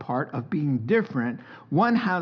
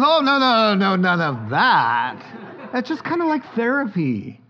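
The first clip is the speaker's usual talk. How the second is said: gushing wife voice, higher and louder; mock wife, higher; 5 semitones higher, 5 dB louder than usual. Preacher imitating his wife about shopping, higher. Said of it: "And she goes".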